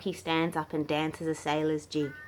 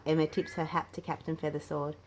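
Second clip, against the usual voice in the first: usual speaking voice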